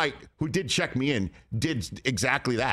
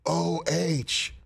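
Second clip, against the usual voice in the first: retold yell; 4 semitones higher than usual. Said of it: "somebody in the back end of the auditorium screams".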